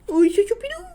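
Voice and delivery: high, loud delighted squeal of a greeting. Real, not acted. Tarot reviewer suddenly greeting Choupidou, delighted high-pitched exclamation.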